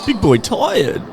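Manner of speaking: lightly voiced baby talk; mock baby talk. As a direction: say it like a proud baby. Echoing the baby-talk joke.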